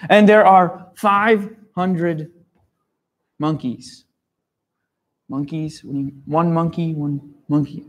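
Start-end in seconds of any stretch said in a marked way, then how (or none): none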